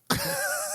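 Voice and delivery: a sudden high airy laugh; high breathy laugh. Real, not acted. Manager laughing at the Car Ramrod code word, airy laugh.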